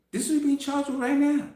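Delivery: emphatic repeat. Shown as a demonstration. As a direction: say it again, urgently.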